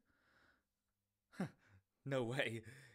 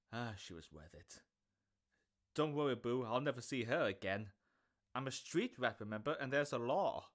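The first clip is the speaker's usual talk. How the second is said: ordinary delivery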